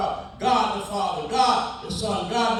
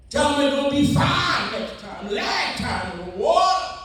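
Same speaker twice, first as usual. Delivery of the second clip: preaching at full force; 3 semitones higher than usual. Acted. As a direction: warn them like judgment day. Preacher voicing God's warning.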